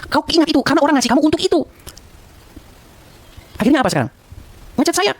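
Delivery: high-pitched indignant scolding; angry scolding. Real, not acted. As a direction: scold him sharply, pitch up. Scolding Rudi in public rant. (omelan marah bernada tinggi)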